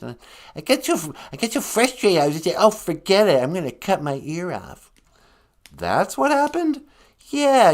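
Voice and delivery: hammed-up frustration rising; frustrated artist. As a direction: insist hotly as the painter. Van Gogh gets frustrated.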